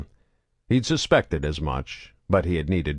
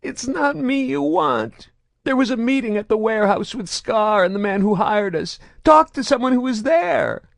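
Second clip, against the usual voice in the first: sobbing pleading; 5 dB louder than usual. A narrator's reading. Prisoner pleads with Saren to look elsewhere. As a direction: plead through tears.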